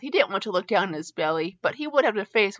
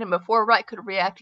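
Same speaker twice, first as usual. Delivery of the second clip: usual speaking voice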